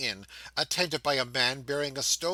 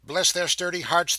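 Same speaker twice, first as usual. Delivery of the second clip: higher and louder, full of wonder at the bells; exultant narration; 5 semitones higher, 5 dB louder than usual. A narrator's reading. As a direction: lift the voice in praise.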